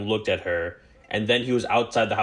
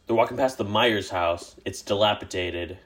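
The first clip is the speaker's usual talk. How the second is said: usual speaking voice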